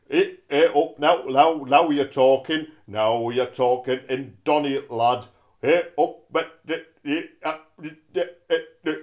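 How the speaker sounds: loud hammed-up Yorkshire voice; mock Yorkshire; Yorkshire accent. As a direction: bellow it like a Yorkshireman. Yorkshire voice Doncaster York.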